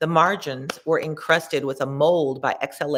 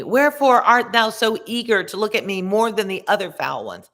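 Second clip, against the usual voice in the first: raised, louder sinner's cry; mock scream; 5 semitones higher, 5 dB louder than usual. A sinner yelling up at Dante from the filth, mock scream. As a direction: call out the sinner's question with a raised voice. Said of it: "He screamed to me".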